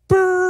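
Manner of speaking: high shivery brrr; shivering brrr. Retold or imitated. Wife's cold reaction to Texas.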